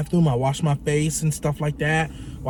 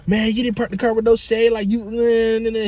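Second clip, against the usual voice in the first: raised-pitch nagging imitation of a passenger; mock complaining; 6 semitones higher than usual. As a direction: imitate him griping, words running into nonsense. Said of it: "He starts complaining about that".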